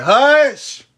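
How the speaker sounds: sudden loud shout of hush; sharp high yell to silence the dogs. Real, not acted. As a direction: yell it across the yard. Yelling at dogs off camera.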